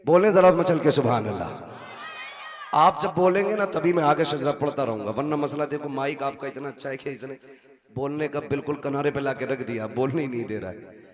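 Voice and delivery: loud, pushing preacher; fervent preaching. Acted. Preacher urging the young men on, loud fervent appeal. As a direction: drive the crowd to shout back.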